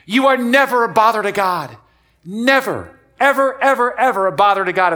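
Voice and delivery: loud, high, insistent preaching; emphatic assurance. Real, not acted. Preacher assures congregation they never bother God, loud and emphatic. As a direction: drive every word home loudly to the crowd.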